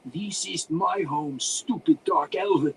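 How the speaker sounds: put-on slow, high, loud accented wizard; indignant, drawn-out character voice; spat angrily. A narrator's reading. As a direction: spit it out as an insult. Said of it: "the human spat".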